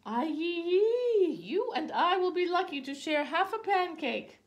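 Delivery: very high, loud lament; exasperated wail. A narrator's reading. Mama to her son over scarce pancakes, exasperated wail. Mother wails over too little food.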